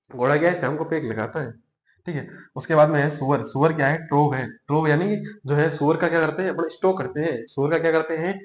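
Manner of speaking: quiet, tired teacher's voice; dropped voice. Real, not acted. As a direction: ease off the volume to save the voice. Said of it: "आवाज धीमी नहीं हुई है".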